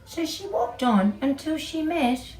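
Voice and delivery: usual speaking voice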